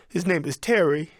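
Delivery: usual speaking voice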